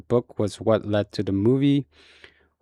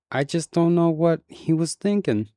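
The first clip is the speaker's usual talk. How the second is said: higher, lightly voiced woman, lost and puzzled; bewildered grieving mother; 7 semitones higher than usual. A narrator's reading. Grieving mother wondering about her dead son, lightly voiced. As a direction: sound like a mother who cannot understand her son.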